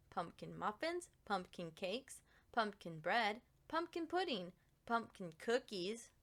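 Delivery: usual speaking voice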